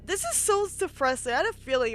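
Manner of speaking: real dismay at the sad scene; exclaimed dismay. Real, not acted. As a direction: cry out at how sad it is.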